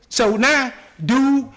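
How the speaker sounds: usual speaking voice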